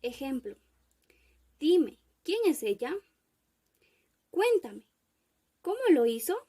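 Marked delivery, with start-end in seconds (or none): 1.6-6.4 louder, with high peaks on "dime" and "Cuéntame"; emphatic demand, "quién" and "cómo" stressed; exclamatory questioning. Shown as a demonstration. Teacher giving examples of indirect questions, emphatic questioning. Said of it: "se refiere de manera exclamativa".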